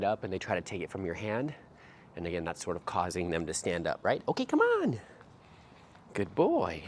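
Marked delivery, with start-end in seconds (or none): 4.3-5.0 much higher, bright go cue; high dog cue. Real, not acted. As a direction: lift it up for the dog. Cueing puppy to move.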